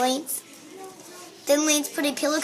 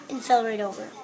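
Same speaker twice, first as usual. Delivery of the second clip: plain reading voice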